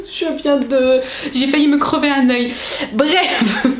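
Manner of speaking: amused, giggling through the words; laughing. Real, not acted. Vlogger admits she nearly poked her eye, laughing. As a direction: laugh off your own clumsy mishap.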